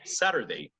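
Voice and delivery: "Saturday" with the T softened to a quick D; "Saturday" with a soft D in the middle; American flapped T. Shown as a demonstration. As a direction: say "Saturday" American style, like "Sader-day". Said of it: "And now American".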